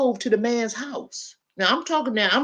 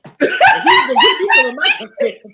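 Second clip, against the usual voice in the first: laughing out the words, much higher; 10 dB louder than usual. Real, not acted. Laughing while telling a friend about his share of the money. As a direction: break into a laugh mid-sentence.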